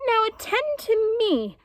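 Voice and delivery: high, slow, commanding jaguar; Painted Jaguar. A narrator's reading. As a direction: jaguar demands attention from the two animals, high and slow. Jaguar demands attention.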